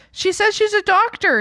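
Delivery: high, insistent mom voice; mom imitation. Mom insisting to her daughter, high. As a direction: defend the doctor like mom.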